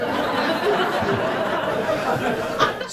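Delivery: airy, high-pitched chuckle; high breathy laugh. Real, not acted. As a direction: let out a silent wheezing laugh. Laughs at own story.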